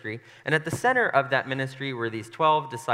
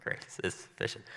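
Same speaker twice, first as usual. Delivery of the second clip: soft, low, breath-heavy irony; hushed sarcastic aside; ironic; 4 semitones lower, 6 dB quieter than usual. Shown as a demonstration. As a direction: toss it off drily, barely voiced. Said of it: "maybe it's a little ironic".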